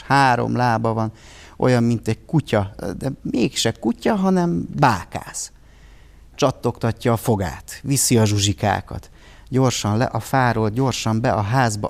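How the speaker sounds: plain reading voice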